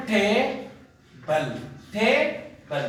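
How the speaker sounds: टेबल in two slow, separate beats; split into syllables. Shown as a demonstration. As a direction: break टेबल apart, टे then बल, with a gap. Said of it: "there are two sound".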